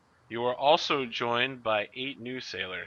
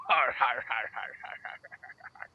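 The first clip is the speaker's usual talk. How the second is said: pirate laugh. Acted. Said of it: "And Master Scourge goes".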